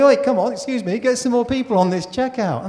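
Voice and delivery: loud, high mock complaint; acted indignant shopper calling out; making a fuss. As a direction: kick up a fuss like an impatient customer. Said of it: "you make a fuss".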